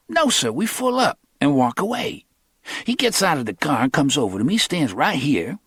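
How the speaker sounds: lightly voiced young hustler; Cornell's voice.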